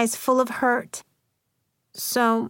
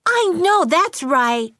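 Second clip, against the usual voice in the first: high, slow indignant cry; furious exclamation; 8 semitones higher, 4 dB louder, slower than usual. A narrator's reading. Athena backing her friend's mom, furiously exclaiming. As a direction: draw it out, pitched high in fury. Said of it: "Athena shouted furiously".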